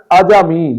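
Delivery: عجمی in three syllables, "a-ja-mi"; short a on the jeem in عجمی; درست تلفظ. Shown as a demonstration. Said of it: "جیم پر زبر ہے". (عجمی، جیم پر زبر کے ساتھ)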